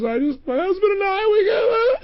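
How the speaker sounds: very high, squealing tearful wail; hammed-up imitation of a woman bursting into tears; mock crying. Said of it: "and she starts to cry".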